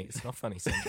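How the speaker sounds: scolding that breaks into a laugh; anger turning to laughter. Pretend. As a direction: fake anger cracking up.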